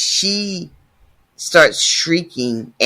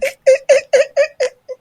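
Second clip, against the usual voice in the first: high burst of laughter. Real, not acted. Reader cracking up at the line, high laugh. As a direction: break into a shrieking laugh.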